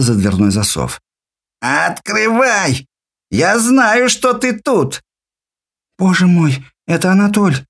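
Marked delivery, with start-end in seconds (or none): 1.6-5.0 put-on high, drawn-out drunken yelling; a sozzled man shrieking through the door; shrill drunk voice. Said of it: "визгливый пьяный голос". 6.0-7.6 frightened gasp. Acted. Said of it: "в ужасе прошептала Тома".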